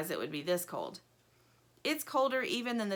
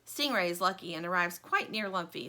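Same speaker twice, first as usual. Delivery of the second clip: usual speaking voice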